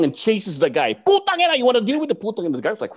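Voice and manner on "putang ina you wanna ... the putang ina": high, loud challenge shout; threatening yell. Retold or imitated. Guru Jun chasing the biggest guy, high angry yell. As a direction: roar a challenge at the big guy. Teacher chases guy with balisong.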